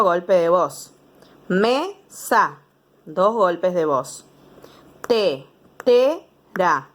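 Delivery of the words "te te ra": te, te, ra, each syllable said apart; three beats. Shown as a demonstration. Teacher to pupils, counting syllables, said in beats. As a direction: beat tetera into three. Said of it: "tres golpes de voz".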